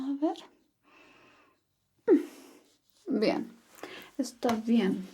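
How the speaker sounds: ordinary talk, nothing marked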